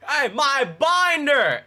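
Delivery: high, slow angry yell over his binder; furious shout. Retold or imitated. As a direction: yell it out, really pissed. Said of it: "I was pissed".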